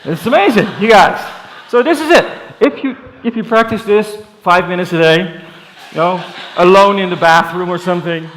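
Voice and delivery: excited. Real, not acted. Excited after group beatbox.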